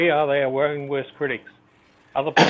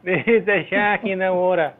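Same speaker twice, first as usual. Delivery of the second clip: mock Aussie accent; 4 semitones higher, slower than usual. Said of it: "the only thing they know how to say is".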